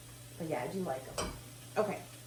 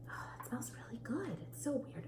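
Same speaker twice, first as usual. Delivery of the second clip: soft whispered aside; whispering; 3 semitones higher, 15 dB quieter than usual. Real, not acted. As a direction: whisper it to yourself. Aside while sniffing the meat.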